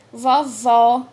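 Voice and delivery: "Vovó" with a loud, open final O; open vowel. Shown as a demonstration. Teacher showing what the acute accent does, open stressed O in "Vovó". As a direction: punch the last O of "Vovó" wide open. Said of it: "Então, indica som aberto".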